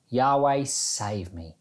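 ordinary delivery

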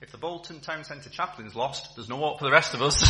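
can barely get the words out for laughing; laughing through punchline. Real, not acted.

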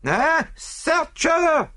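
very high, stretched-out shriek; French maid screaming to search her rival. Acted. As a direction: scream it at the police, drawn out. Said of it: "screamed the other".

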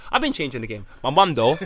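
normal talking voice

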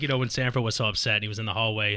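normal talking voice

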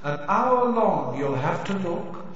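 slow, high eerie chant; drawn-out spectral murmur like a ghostly chorus; haunting voice. Acted. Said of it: "a chorus of eerie voices singing".

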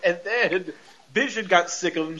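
ordinary talk

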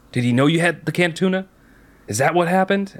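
questioning sheriff. Retold or imitated. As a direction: press the kid with questions.

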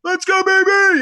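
high, loud, hyped announcer shout; Dick Vitale. Retold or imitated. Birthday tribute to a famous commentator, a loud hyped shout. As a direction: bellow it like a courtside TV commentator. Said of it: "Happy birthday, right, to Dickie V".

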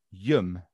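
"yum", said low as one short Brummie word; Brummie contraction. Shown as a demonstration. Explaining Birmingham speech, "yum" as one word. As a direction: squash "are you" into a low "yum".

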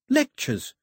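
sudden high-pitched outburst; bursting out. A narrator's reading. Daphne interrupting the talk with an idea, bursting out. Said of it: "Daphne burst out".

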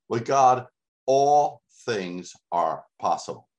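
God loud, then slow all things; emphatic proclamation. Acted. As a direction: stress God, slow down the rest. Preacher proclaims verse.

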